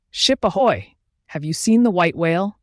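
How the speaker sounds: hailing shout. A narrator's reading. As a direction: call out across the water to a passing ship. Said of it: "Ahab shouted, hailing a ship".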